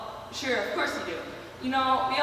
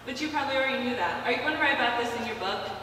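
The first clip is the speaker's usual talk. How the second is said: ordinary delivery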